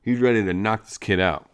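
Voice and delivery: ordinary talk, nothing marked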